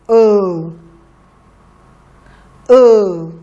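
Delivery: short "uh." held alone and clear; dictated sound. Shown as a demonstration. Teacher dictates a sound for the children to write. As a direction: say "uh." as a clean isolated vowel. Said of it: "please write".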